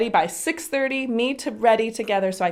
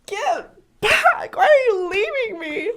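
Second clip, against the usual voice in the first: put-on high, louder clingy child cry; whiny wail; 12 semitones higher, 4 dB louder, slower than usual. Mom imitating daughter begging her back. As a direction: wail like an abandoned toddler.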